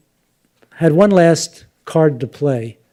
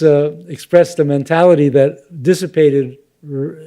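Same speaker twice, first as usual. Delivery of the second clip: ordinary talk, nothing marked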